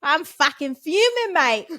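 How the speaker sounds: mock angry British rant; fuming like a Londoner; British accent.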